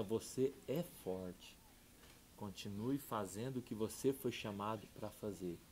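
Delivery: soft, slow, reassuring words to a son; gentle blessing. Retold or imitated. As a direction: lay a calm hand on him with the voice. Stranger reassures him again.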